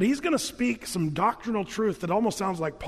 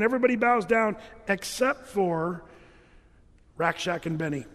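ordinary talk, nothing marked

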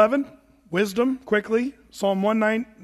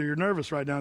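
ordinary talk

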